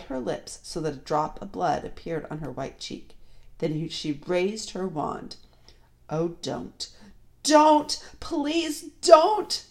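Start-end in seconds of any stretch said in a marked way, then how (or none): 6.1-9.6 shouted plea. A narrator's reading. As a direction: start contained, then cry out begging. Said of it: "shouted Edmund".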